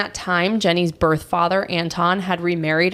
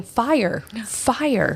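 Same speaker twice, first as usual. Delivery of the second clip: mild narrated yell; 4 semitones higher than usual. A narrator's reading. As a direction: call out fire in a restrained storyteller's yell. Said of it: "she ran out yelling".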